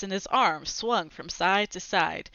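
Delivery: ordinary delivery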